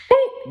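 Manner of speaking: high, loud "boop" of a blow; punch sound effect. Retold or imitated. Imitates a blow landing.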